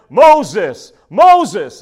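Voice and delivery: loud, drawn call of a name. A narrator's reading. God to Moses from the burning bush, calling out. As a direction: call out firmly to summon someone. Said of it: "God called to him from the midst of the bush".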